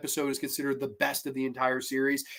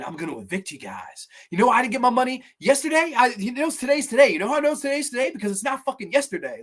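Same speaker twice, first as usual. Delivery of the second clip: mock landlord rant, higher and fast; a hammed-up furious landlord; 8 semitones higher, faster than usual. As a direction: rattle it off like a ranting landlord. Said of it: "goes on this whole rant".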